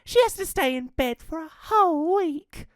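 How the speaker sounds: put-on high little girl voice; young girl Red. Red explains Gran's cold, high girl voice. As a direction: speak it as a small girl. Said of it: "explained Red".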